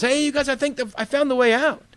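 a lightly voiced friend who found the exit; excited Mario. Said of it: "he goes".

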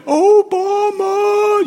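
very high, slow drawn-out dog howl of words; howling talking dog. Retold or imitated. Imitating viral talking dogs, high stretched howl. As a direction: howl it like a dog trying to talk. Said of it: "they would howl in such a way".

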